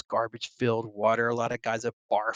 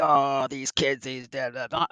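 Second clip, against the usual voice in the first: put-on grumpy old-timer. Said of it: "old school guys that was like".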